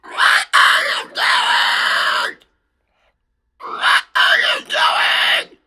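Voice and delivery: very slow, loud whispered scream; long eerie whisper-shout to spook a hacker; drawn-out whisper scream. Shown as a demonstration. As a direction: stretch every word in a haunting whisper-yell. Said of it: "I don't know what I would do".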